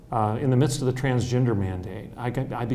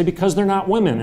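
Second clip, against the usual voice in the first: voicing opponent; 8 semitones higher, 7 dB louder than usual. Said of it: "And you're gonna say".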